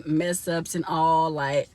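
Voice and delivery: ordinary delivery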